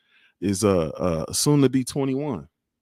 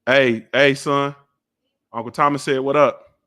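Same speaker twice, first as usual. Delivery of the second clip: raised voice to get attention; calling out; 2 semitones higher, 6 dB louder than usual. Real, not acted. Dad calls his son to pass on a greeting, calling out. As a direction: holler over to someone off screen.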